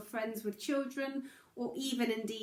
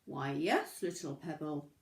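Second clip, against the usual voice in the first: moose; 5 semitones lower than usual. Acted. Moose answers the lost pebble, lower calm voice. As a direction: drop the voice down for the big calm moose. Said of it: "the moose replied".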